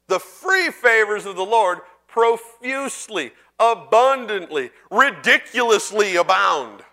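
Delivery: slow, weighty build; slow emphasis. Real, not acted. Preacher to church, slow emphatic build. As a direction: lean on each adverb.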